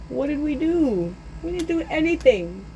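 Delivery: high-pitched, mock-hurt whine; whining protest. Children finding coal for presents, high whiny protest. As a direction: plead innocence in a high voice.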